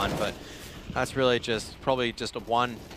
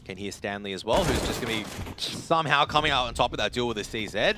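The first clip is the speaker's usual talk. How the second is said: normal talking voice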